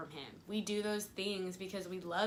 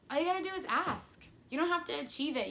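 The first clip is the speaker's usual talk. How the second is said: quick, higher-pitched imitated reassurance; lightly voiced God, brisk and insistent; God reassuring; 7 semitones higher, faster than usual. God answering people who beg him, lightly imitated reassurance. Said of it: "And he's like".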